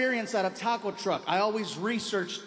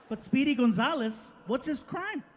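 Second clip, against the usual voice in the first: ordinary delivery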